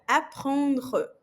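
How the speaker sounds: "Apprendre" com o R raspado na garganta; throaty French R. Shown as a demonstration. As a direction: puxe o R do fundo da garganta. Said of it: "Limpando a garganta". (R gutural francês)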